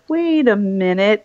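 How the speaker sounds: slow, drawn-out double take, rising at the end; mock suspicious realisation, stretched out; retold dawning recognition. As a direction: imitate someone slowly placing a face. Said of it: "he was like".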